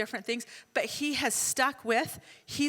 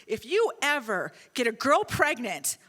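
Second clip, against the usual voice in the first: stern parental warning; 5 dB louder than usual. Retold or imitated. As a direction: lay down the law firmly. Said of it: "says it to me in the same way".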